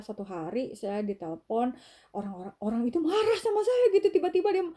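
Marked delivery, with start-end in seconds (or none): 2.2-4.6 rushed, high, loud retelling of the angry call; high-pitched rush of disbelief; excited retelling. Real, not acted. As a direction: tell it fast and worked up. Retelling angry caller, voice jumps.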